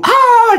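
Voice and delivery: a sudden high, loud squeal of a laugh; imitated delighted squeal. Minister's wife laughing at the host's joke, imitated squeal. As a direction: mimic her happy shriek of laughter. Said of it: "dia ketawa gitu".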